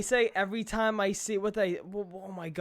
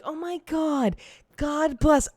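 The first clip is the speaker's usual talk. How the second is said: lightly acted pious exclamation, pitched up; 6 semitones higher, slower than usual.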